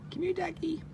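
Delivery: very high, soft coaxing. Real, not acted. Woman coaxes a duck over in a very high soft voice. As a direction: coax the duck over, cooing.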